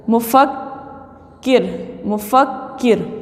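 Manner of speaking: "मुफक्किर" slowly broken as mufak, pause, kir; slow dictation of "मुफक्किर", splitting the double k; double consonant. Shown as a demonstration. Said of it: "पढ़ने में तो दो मरतबा होगा". ("मुफक्किर" को धीरे, मुफक-किर तोड़कर)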